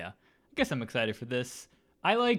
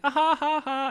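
high, loud forced chuckle; fake laugh; 8 dB louder than usual. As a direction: imitate a fake awkward laugh. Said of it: "she'll just be like".

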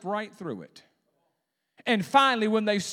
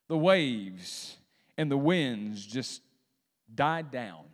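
normal talking voice

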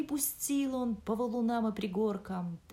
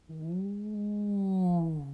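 long eerie wolf howl; 3 dB louder than usual. Acted. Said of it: "завыл волк". (волчий вой)

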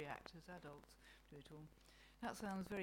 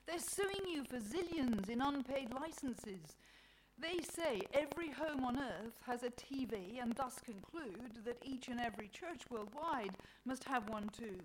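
raised, indignant complaint; cross; 6 semitones higher, 7 dB louder than usual. A narrator's reading. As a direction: list the grievances crossly, higher and louder. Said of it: "says Mother Nature crossly".

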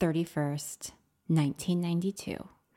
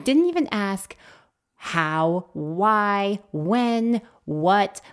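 normal talking voice

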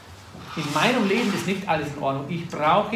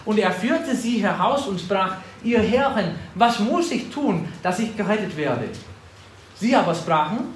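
louder, higher preaching voice; preaching scripture; 3 semitones higher, 3 dB louder than usual. Acted. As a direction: declaim the Bible passage to the congregation. Preacher reads scripture aloud.

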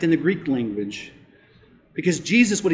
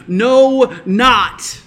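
emphatic no; 6 semitones higher, 9 dB louder than usual. Acted. Emphatic double negative.

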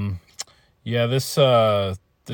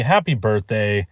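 ordinary talk, nothing marked

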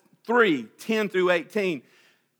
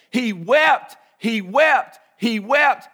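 he wept, louder each time; rising emphatic cry; 4 semitones higher, 7 dB louder, much slower than usual. Real, not acted. Preacher to congregation, building fervor. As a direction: pound out the repeated words with rising force.

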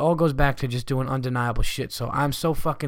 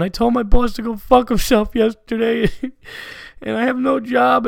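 much higher, louder mock-sobbing voice with a laugh breaking in; mock crying letter writer; 11 semitones higher, 7 dB louder than usual. Fan reading his letter to the comic, hammed-up crying whine. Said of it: "like, crying".

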